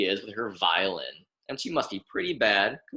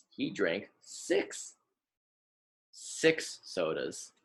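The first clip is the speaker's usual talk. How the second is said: slower and softer, leaning on the word six; mock-serious, hushed six sodas; slow and soft emphasis; 4 semitones lower, 6 dB quieter, slower than usual. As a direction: spell it out gently for small listeners. Stressing the number for children.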